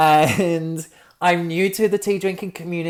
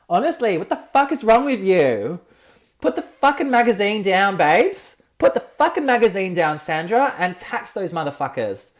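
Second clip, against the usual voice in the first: indignant scolding, pitched up and easing at the end; exasperated comic tirade, voice raised; angry, revved-up rant; 4 semitones higher, 4 dB louder than usual. Real, not acted. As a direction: get worked up and tell them off. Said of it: "this would be an angry episode".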